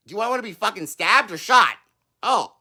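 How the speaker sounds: usual speaking voice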